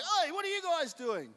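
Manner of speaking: high, loud challenge to strangers; confronting call. Retold or imitated. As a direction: call them out sharply. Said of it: "And he's like".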